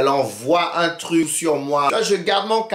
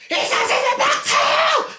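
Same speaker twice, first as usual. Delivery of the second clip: very high screaming, slower, with laughter breaking through; screaming, laughing; slower than usual. Retold or imitated. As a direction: scream it at the top of the voice, barely holding back laughter. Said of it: "je commence à crier".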